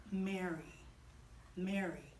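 very soft, low, loving call; special, tender naming. Acted. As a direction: call the name gently and low. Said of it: "the way he said her name was very special".

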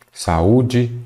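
ordinary delivery

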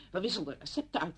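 ordinary delivery

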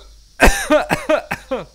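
loud choking cough; coughing fit. Real, not acted. Choking on a drink.

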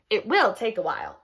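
plain reading voice

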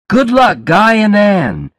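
high, celebratory call; shouted good wishes. A narrator's reading. Wedding guests to bride and groom, shouting. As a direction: cheer the newlyweds on. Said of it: "People were all shouting".

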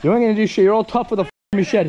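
high, loud defiant challenge; angry taunting. Real, not acted. Taunting a man tough only with a machete, angry shout. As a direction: call his bluff loudly.

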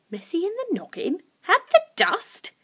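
put-on very high, puzzled girl; confused questioning. A narrator's reading. Lucy echoing Sniff's odd words, confused. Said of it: "Lucy asked, confused".

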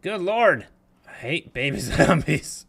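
startled shout into a laugh; panicking gamer. Real, not acted. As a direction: yelp in surprise, then laugh. Startled by baby zombie.

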